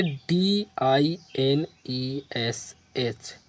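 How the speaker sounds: letter by letter, spaced out; dictating letters to a class; slow spelling. Shown as a demonstration. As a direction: spell each letter slowly. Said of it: "spelling the letters out".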